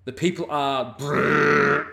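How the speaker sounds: words breaking up into a stuttering buzz; cut-out stream audio. Retold or imitated. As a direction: mimic a glitching stream dropping out. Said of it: "the stream cut out at the worst time".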